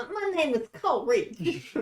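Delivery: high, drawn-out character introduction; put-on character voice. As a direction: announce the name slowly in a high voice.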